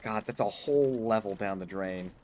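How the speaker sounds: ordinary delivery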